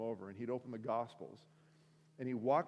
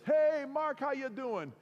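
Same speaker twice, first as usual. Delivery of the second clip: high, loud, hearty hello; exuberant greeting; 12 semitones higher, 6 dB louder, slower than usual. Retold or imitated. Regular customer greeting deli worker, exuberant. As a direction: burst in with an over-eager hail.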